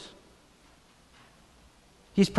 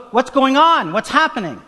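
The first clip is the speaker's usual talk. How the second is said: higher, louder urgent questions; agitated questioning; 9 semitones higher, 6 dB louder than usual. Acted. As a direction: call out over the commotion, pitched up. Beggar startled by passing commotion.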